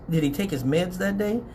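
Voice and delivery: normal talking voice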